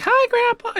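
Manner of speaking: put-on high-pitched kids' hello; acted grandkid greeting. Grandkids visiting their grandpa, acted high-pitched greeting.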